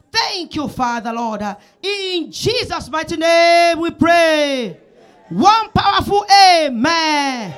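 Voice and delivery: fervent prayer. Acted.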